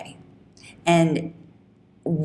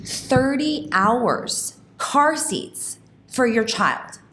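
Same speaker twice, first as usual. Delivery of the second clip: high, slow and deliberate; emphatic; 7 semitones higher, much slower than usual. Real, not acted. Host making her point to listeners, slow and raised. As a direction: spell it out slowly for emphasis.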